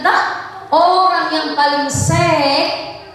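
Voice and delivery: ordinary talk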